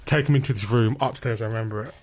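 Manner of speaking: ordinary talk, nothing marked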